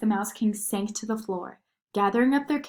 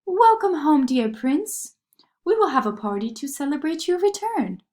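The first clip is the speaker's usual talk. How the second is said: sugar plum fairy; 7 semitones higher, 6 dB louder than usual. A narrator's reading. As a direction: sing it out like a dainty fairy. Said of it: "said the sugar plum fairy".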